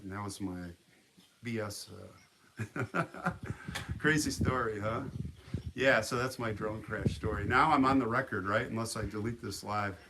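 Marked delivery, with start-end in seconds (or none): 3.0-3.9 short breathy laugh; real chuckle. Real, not acted. Storyteller amused by own cover story, chuckling. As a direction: chuckle at your own fib.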